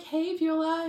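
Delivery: a bright, loud, high-pitched hello; excited greeting. Retold or imitated. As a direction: call out her name, delighted to see her. Greeting her friend Beulah.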